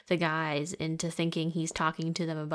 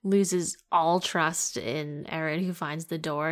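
ordinary talk, nothing marked

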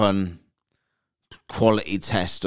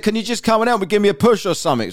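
imitated plea, higher and quicker at the end; struggling colleague; 10 semitones higher, 4 dB louder, faster than usual. Sweating coworker asking for a push, mildly exasperated imitated plea. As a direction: call for help as the stuck workmate. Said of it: "He's really sweating".

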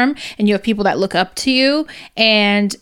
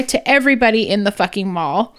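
ordinary talk, nothing marked

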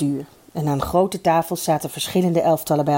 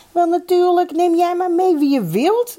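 high, raised phone shout; calling down the phone; 13 semitones higher, 4 dB louder than usual. A narrator's reading. Mannes gives Eddy permission by telephone, calling out high. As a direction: call it out cheerily high. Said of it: "riep Mannes door de telefoon".

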